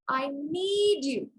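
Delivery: "need" stretched out and lifted, slowly; stress on NEED. Shown as a demonstration. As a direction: lean long on the word "need". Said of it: "I'm gonna stress the second word".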